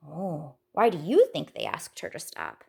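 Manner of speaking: ordinary delivery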